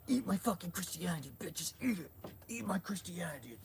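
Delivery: high, put-on mocking voice; mock preacher voice. Streamer imitating a preacher forcing her religion, mockingly.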